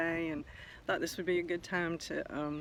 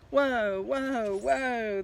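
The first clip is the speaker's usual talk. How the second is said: higher, louder whoas as he tumbles; rolling yell; 6 semitones higher, 5 dB louder, much slower than usual. Acted. Little egg yells while rolling down the grassy hill, higher and louder. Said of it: "the little egg yelled as he was rolling".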